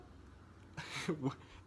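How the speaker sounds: soft, breath-only laugh. Real, not acted. Seller laughing at the buyer's insults, amused. Laughing at his retold shock.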